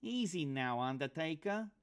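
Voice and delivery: a weaselly sidekick needling his mark; pinched high voice. A narrator's reading. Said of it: "A pinched voice, high, all nose and teeth".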